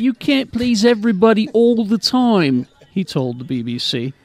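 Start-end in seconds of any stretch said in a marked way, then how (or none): 0.0-2.7 higher put-on voice for the builder; acted quote. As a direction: play the quoted man, pitched up. Reading a quoted man's words.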